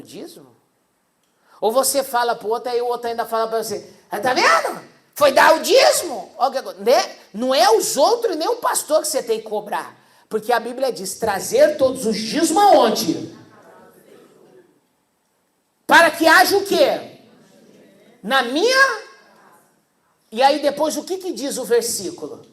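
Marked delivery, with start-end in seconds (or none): 4.1-6.3 high, loud taunting jeer; mock told-you-so taunt; mocking neighbour. 11.2-19.0 loud, rising call to the crowd; loud call-and-response question; preaching question. Acted. Preacher quizzing congregation from Bible.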